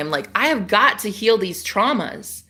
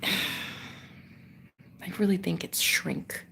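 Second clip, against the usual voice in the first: conspiratorial whisper; 3 semitones lower, 13 dB quieter, much slower than usual. Real, not acted. Telling viewers her roommate is a shrink, in a slow whisper. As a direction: sigh then a slow whisper. Secretive guess about roommate.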